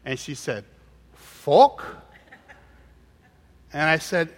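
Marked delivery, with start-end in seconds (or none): 1.1-1.8 put-on loud, rising Chinese-accented "Fork" with no R; higher, puzzled Chinese-accented question; imitated frustrated waitress. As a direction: imitate a baffled Chinese waitress, pitch jumping up. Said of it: "she's really frustrated".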